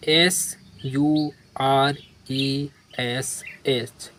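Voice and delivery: letter by letter, spaced out; dictating letters to a class; slow spelling. Shown as a demonstration. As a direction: spell each letter slowly. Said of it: "spelling the letters out".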